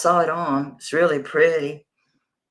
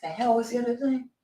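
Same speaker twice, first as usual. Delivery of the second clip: whispering; 8 semitones higher, 5 dB quieter than usual. Real, not acted. Muttering to herself, whispered.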